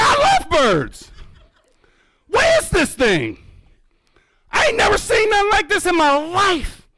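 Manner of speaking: very high, overwrought cry; hammed-up high-pitched rapture; ecstatic amazement. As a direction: wail it in overjoyed disbelief. Retelling amazed reaction to osprey.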